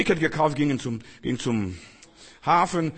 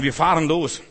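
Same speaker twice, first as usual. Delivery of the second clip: eager rush; 4 semitones higher, 9 dB louder than usual. Acted. Impatient believer rushing ahead.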